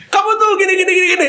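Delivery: high, laughing imitation of a scolding girlfriend; mock nagging girlfriend. Imitating a girlfriend scolding her partner, high mock nagging with a laugh. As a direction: ham up a nagging partner's rant.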